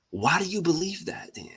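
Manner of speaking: unvoiced, breathy whisper of the question. Shown as a demonstration. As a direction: whisper it to go deep, intimate. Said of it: "you gotta go deep".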